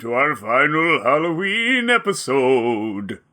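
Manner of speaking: slow, lightly spooky host voice; a hint of a Halloween villain. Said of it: "you came up with a spooky voice".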